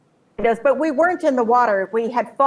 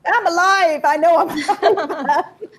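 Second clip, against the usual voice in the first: high, laughing declaration of being alive; bursting with happy laughter; joyful exclamation; 6 semitones higher, slower than usual. Real, not acted. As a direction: exclaim it gleefully and break into laughter.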